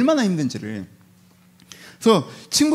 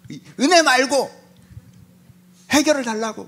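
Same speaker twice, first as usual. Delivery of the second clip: higher-pitched acted protest; exasperated demand; 6 semitones higher than usual. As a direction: demand it in frustration. Voicing Job's frustrated demand to God.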